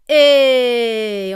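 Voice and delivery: loud, long call gliding downward; pressing call-out. Shown as a demonstration. Teacher demonstrating spoken-voice exercise, loud gliding call. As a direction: call out as if warning someone in danger. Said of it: "un exercice en voix parlée qu'on va faire".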